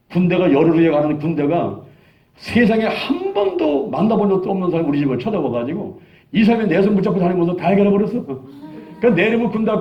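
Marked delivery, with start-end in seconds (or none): none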